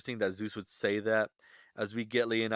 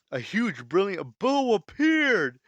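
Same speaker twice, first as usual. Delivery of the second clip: high, slow, loud announcer call; announcer proclamation; 9 semitones higher, 6 dB louder, slower than usual. Acted. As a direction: proclaim it to the whole arena. Announcer Heimdall proclaims the bow.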